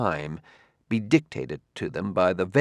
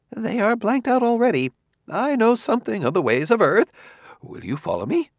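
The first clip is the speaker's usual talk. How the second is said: high-pitched man voice; 12 semitones higher than usual. A narrator's reading. Alien sociologist speaks to Bailey.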